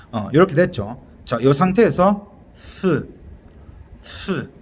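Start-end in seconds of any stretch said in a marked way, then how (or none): 2.5-3.1 breathy th held on its own; isolated voiceless th hiss; th sound. Shown as a demonstration. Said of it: "호흡을 밖으로 내쉬어줘야 돼요". 4.0-4.5 voiceless th, just blown air; soft isolated th demonstration; th sound. Shown as a demonstration. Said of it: "호흡을 밖으로 내쉬어줘야 돼요".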